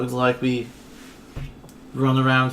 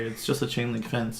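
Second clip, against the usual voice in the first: ordinary talk, nothing marked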